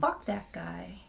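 soft, slow, over-it dismissal; dismissive. Retold or imitated. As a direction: write him off, slowly. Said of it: "I was like".

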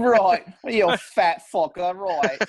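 drawn-out Aussie taunt; exaggerated Australian bully voice; mock Australian accent. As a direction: jeer slowly in put-on Australian. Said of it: "to this Australian".

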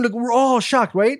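usual speaking voice

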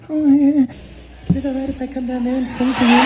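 high, fast, breathy-free falsetto imitation; imitating a high soft pop star. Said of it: "that wasn't talking like that".

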